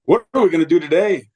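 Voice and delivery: put-on high, loud, thrilled little kid; a four-year-old bursting to start the day; excited child. Grandpa retells his grandson waking up thrilled, high and loud. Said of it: "He was so excited".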